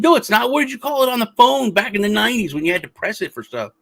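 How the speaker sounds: mock argumentative yell, high-pitched; retold yelling. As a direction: protest loudly, as if retelling a row. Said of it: "we're yelling at each other".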